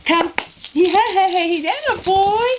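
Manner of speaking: loud excited praise for a good dog; excited cheer. Real, not acted. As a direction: cheer him on loudly. Cheering puppy fetching.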